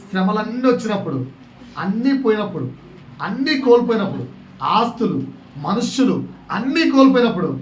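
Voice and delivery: ordinary talk, nothing marked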